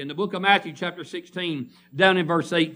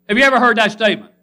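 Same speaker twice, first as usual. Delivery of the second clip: loud; 5 semitones higher, 9 dB louder, much faster than usual. Acted. Preacher repeats question, much louder.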